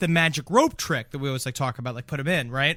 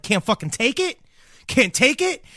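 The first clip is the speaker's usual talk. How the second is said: retold taunt; 6 semitones higher than usual. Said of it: "going like".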